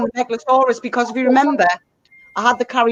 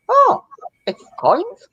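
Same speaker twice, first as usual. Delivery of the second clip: surprised; 10 semitones higher, much slower than usual. Retold or imitated. Retelling own surprise at opening.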